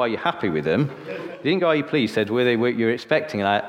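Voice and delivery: ordinary talk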